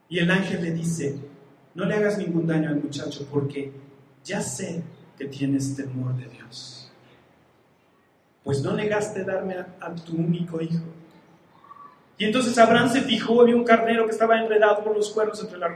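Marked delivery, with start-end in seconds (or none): 1.7-10.8 solemn angel voice. Acted. Voices the angel speaking to Abraham.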